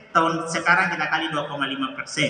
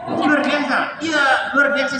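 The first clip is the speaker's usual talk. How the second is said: high, loud, half-laughing cry of amazement; overwhelmed exclamation; 7 semitones higher, 5 dB louder than usual. Real, not acted. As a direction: burst out at how moving it was. Exclaims at the moving moment. (seruan haru, tinggi dan keras)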